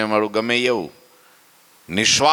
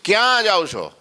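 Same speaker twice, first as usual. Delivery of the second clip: a questioner's voice, pitched well up; high sharp question; 9 semitones higher than usual. Retold or imitated. Sura Bapu asks the leaving family, high and startled. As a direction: ask where they're going, startled.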